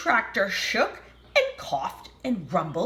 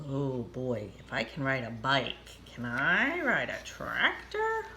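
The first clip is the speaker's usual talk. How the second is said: thoughtful duck voice; 7 semitones lower, 4 dB quieter than usual. Acted. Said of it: "he was kind of thinking".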